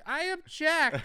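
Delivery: put-on high, slow, squeaked-out little-boy objection; squeaky timid objection. Friend imagines Dale objecting at the wedding, tiny high squeak. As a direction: pipe it up like a nervous little kid. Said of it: "And then he'd be like".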